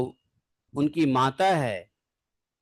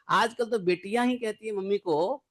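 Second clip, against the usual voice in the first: ordinary delivery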